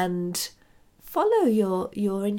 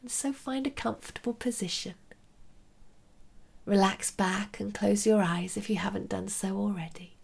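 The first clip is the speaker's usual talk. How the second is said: soft whispered meditation guidance; right in your ear; 4 dB quieter than usual. Acted.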